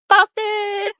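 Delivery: Boston! called out high as a hype greeting; greeting shout. Pretend. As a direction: belt the name out like a friend just walked in. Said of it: "That's gonna be my thing from her now".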